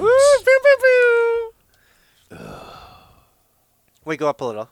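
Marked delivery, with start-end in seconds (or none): 0.0-2.5 very high playful laser noises; pew pew sound effects. Real, not acted. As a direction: zap the air like a toy blaster. Cheering sound effect for story.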